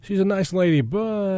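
high, loaded "but" left hanging; mock gossip. Shown as a demonstration. Cohost acting out the backhanded compliment, high and knowing. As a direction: set up the catty "but". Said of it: "you have to say the word "but".

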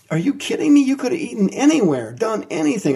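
high incredulous outburst; exasperated disbelief. Acted. Preacher voices God exasperated.

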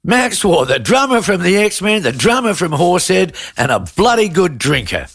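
big high reveal of the guest's name; grand announcement. Acted. Host unveils the surprise guest to his co-host, high and excited. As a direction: present him like a showman.

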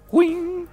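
high mock microwave ding. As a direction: squeak it like a microwave finishing. Imitated microwave noise.

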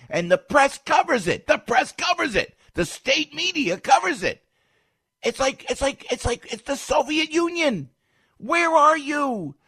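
high, loud indignant yelling; outraged shouting. Real, not acted. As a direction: shout it, outraged, stretching the last call. Angry political rant, calling out the media.

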